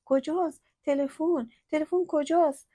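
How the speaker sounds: high, loud panicked asking; frantic search. A narrator's reading. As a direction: burst in asking for the phone. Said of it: "مریم هراسون اومد".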